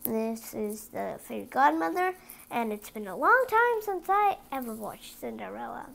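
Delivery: ordinary delivery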